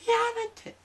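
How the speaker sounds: shrill high yelling in Japanese; panicked shriek of protest; freaking out. Retold or imitated. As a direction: girlfriend screaming at him in the club. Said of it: "She's, like, going off".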